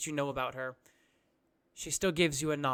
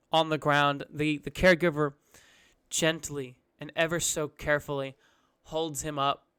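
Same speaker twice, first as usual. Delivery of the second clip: usual speaking voice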